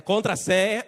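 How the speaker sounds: loud, high demand for countersign; password at the door. Acted. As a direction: call it sharply through the door.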